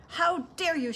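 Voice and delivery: high-pitched indignant outburst; outraged shout. A narrator's reading. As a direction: shout it, affronted. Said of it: "she shouted".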